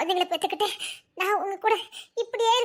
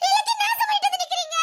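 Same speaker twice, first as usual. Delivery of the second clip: much higher, shrieking accusation; crying yell; 10 semitones higher, faster than usual. Acted. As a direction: fling it at him through tears. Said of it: "நீ எப்படி கத்துற". (அழுகையுடன் கத்துதல்)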